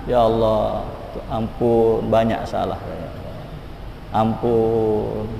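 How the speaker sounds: soft penitent murmur; quiet, lightly acted night-prayer confession; mock tearful plea. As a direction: act out begging forgiveness, hushed and drawn out.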